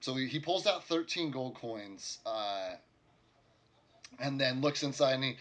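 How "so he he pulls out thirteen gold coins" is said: ordinary delivery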